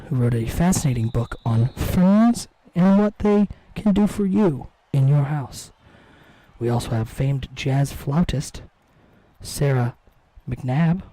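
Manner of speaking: low, slow, mellow public radio host read; slow and smooth like a radio host; NPR voice. Pretend. Streamer showing his NPR voice, slow and low radio host read. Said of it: "that's my N P R voice".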